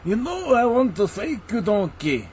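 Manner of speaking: lightly hammed Shrek with a laugh breaking through. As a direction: do a giggling Shrek thanking donkey. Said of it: "I only do Shrek".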